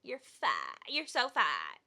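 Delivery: low, creaky imitation of her; mock her fried, dragging voice; lightly hammed vocal fry. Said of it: "like, that vocal fry?".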